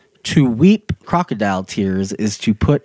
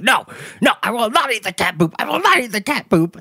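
high mock refusal from Anchorman; quoting Anchorman; 10 semitones higher than usual. Anchorman character refusing cat poop, high mock voice. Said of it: "And he's like".

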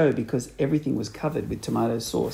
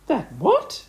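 shrill startled; 9 semitones higher than usual. A narrator's reading. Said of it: "screamed Missus Twit".

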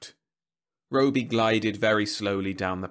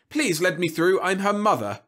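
higher, louder plea to the crowd; lightly voiced urgent mother; mother pushing through; 10 semitones higher, 7 dB louder than usual. A narrator's reading. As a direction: call out to be let past.

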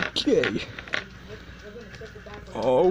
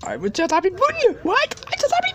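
squealed protest; 7 semitones higher, much faster than usual. Real, not acted. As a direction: pitch climbing to a high squeak. Whining protest after losing.

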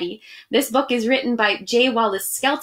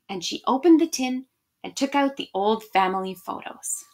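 usual speaking voice

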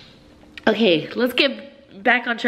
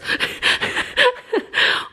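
giggle. Real, not acted. Delight over the necklace.

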